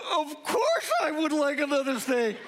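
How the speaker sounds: high, emphatic burst of eagerness; gleeful outburst. Acted. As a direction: burst out as if it were obvious. His inner answer to the offer.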